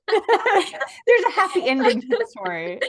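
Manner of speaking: bursting into delighted laughter as you speak; laughing. Real, not acted. Laughing at happy news.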